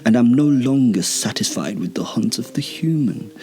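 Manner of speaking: imperious, menacing vampire speech; haughty female vampire. Acted.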